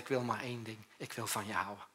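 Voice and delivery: soft, tender declaration of love, voice dropped low; hushed, loving whisper-like vow; God's tender voice. Acted. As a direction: speak it gently as a promise. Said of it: "Maar hij zegt".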